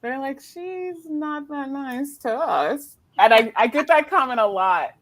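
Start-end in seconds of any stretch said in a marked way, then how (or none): 0.4-3.0 high, slow drawn-out grumble; mock complaint. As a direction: imitate siblings moaning about big sister. Family complaining about her.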